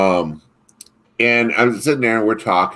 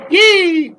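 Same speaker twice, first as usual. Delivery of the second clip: high loud squeal of joy; mock cheer; 6 dB louder than usual. Student celebrating detention never got enforced, gleeful cheer. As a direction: cheer like a kid who got away with it.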